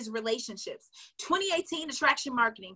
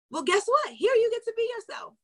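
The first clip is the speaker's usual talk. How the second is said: excited; 9 semitones higher, 5 dB louder than usual. Real, not acted. Coaching excitement.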